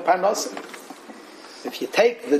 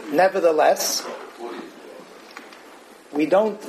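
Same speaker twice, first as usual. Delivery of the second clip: usual speaking voice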